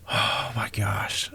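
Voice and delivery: gutted after hours of work lost; crushed. Real, not acted. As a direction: heartbroken under the breath. Yard he built is gone.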